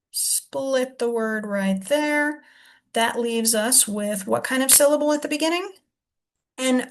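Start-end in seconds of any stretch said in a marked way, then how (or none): 0.1-2.4 slow and deliberate. Shown as a demonstration. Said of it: "teacher marks split".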